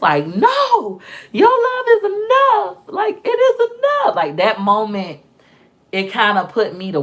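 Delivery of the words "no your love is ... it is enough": very high, drawn-out plea; anguished reassurance. Retold or imitated. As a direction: reenact desperately reassuring a child in a high, stretched voice. Mother's anguished reassurance to son, retold.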